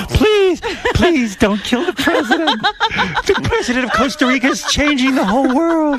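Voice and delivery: high falsetto begging; mock pleading partner. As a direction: plead in a squeaky, hammed-up high voice. Plays a pleading role-play partner.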